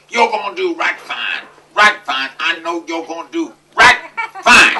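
loud, high cowboy drawl across the room; yelling Texan surgeon. Retold or imitated. Surgeon greeting the patient from across the room, loud Texan yell. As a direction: boom it out like a cheerful Texas doctor. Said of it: "he yells from across the room".

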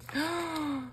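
high, soft indrawn gasp; shocked gasp. Real, not acted. Opening a mystery package, a high shocked gasp. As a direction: gasp in real surprise.

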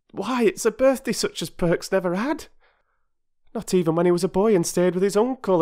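high, fast, overflowing praise; mother thanking the children for the presents; gushing grateful mother. Acted. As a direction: pour out your gratitude. Mrs Perks gushing.

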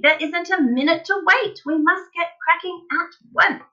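high, emphatic urging; impatient shouting. A narrator's reading. Father demanding they start at once, shouting. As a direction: drive each point home excitedly. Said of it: "shouted Mister Cranky".

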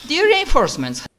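high, fast smug taunt; smug villain. Acted. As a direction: gloat like a villain. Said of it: "smug and very".

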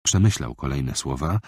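ordinary delivery

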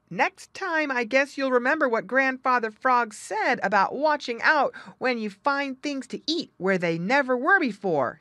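a friend reminding him of the warning; Jerry scolding. Acted. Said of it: "said Jerry".

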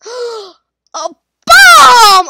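slow loud gasp then shout. Real, not acted. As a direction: gasp and yell it out in shock. Finds bomb in game.

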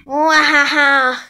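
big evil cackle, half shouted; hammed-up villain laugh. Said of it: "I'm an evil villain".

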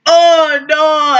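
mock dismay. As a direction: call out in exaggerated alarm. Acts out bystander's alarmed cry.

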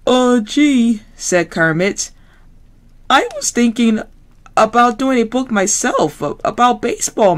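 uneasy frog, hedging; Kermit voice. Acted.

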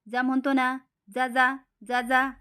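put-on angry child. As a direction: stamp it out like a cross little boy. Boy angrily pushes aunt out. (चिडलेला मुलगा, मोठ्याने जा जा करत)

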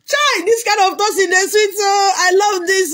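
high, loud delighted exclaiming; excited delight. Real, not acted. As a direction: shout it out, stretching the ends in delight. Delighted reaction to flattering toasting.

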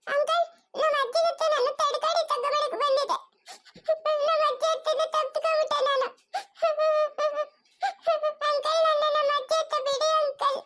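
put-on a small girl begging to be taken to grandma; high, whimpering child voice; lost little girl.

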